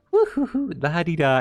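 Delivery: high, sing-song, carefree "woo-hoo-hoo"; carefree skipping lamb. Retold or imitated. Voicing the lamb standing still, sing-song and carefree. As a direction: sing it like a lamb skipping along without a care. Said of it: "you're just like".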